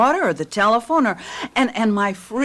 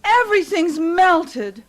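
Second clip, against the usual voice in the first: higher, slow, shouted dismay; dismayed cry; 8 semitones higher than usual. Acted. Wife finding the melted freezer, crying out in dismay. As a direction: cry out at the ruined food.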